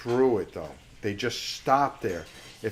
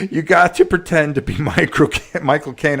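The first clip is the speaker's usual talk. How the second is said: laughing; 4 semitones higher, 12 dB louder than usual. Real, not acted. Laughing while reading chat.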